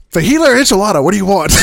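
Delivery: loud, high, pushy waitress voice; mock impatient waitress demanding an order now. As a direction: bark out the choices at the customers. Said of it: "And she's all like".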